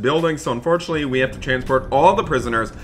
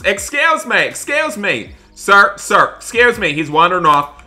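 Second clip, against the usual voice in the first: calling out; 7 semitones higher, 5 dB louder than usual. Pretend. Deputy calls after wandering prisoner.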